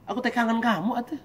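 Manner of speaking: high, pleading I-miss-you; God speaking to a distant servant; longing tenderly. Acted. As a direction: say it like you ache for them. God's voice missing the servant.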